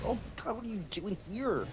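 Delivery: high, startled gasp of disbelief; imitating a shocked greeting; mock shocked surprise. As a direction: act floored to see her there. Shocked reaction at wedding.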